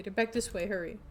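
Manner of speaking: ordinary talk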